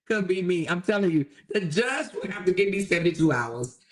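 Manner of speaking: normal talking voice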